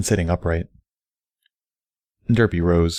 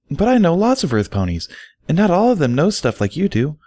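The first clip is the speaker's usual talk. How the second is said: Derpy protesting; 10 semitones higher than usual. Acted. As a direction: push back, pitched high and eager.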